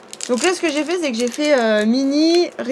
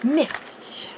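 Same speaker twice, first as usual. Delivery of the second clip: whisper; 7 dB quieter than usual. Real, not acted. Whisper while busy.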